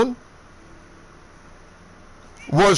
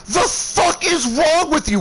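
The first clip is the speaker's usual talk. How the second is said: quick, high shouted outburst; enraged snap, pitched way up; furious yell; 11 semitones higher than usual. Retold or imitated. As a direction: spit it out at him in rage. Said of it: "I am livid".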